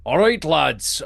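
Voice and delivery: put-on first take of Dozer with an accent; a slight put-on sailor accent, which the GM drops; rejected accent take. Scene: GM voicing Dozer's first order, with an accent. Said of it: "he doesn't have an accent like that".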